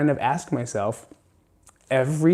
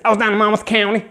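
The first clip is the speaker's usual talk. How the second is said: put-on fast, higher country accent bragging, slowing at the end; louder country accent coach voice; 5 semitones higher, 5 dB louder than usual. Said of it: "Monmouth County has a country accent".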